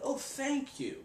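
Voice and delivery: hammed-up courteous reply; gentleman. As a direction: thank her with mock gallantry. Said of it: "makes me feel like a gentleman".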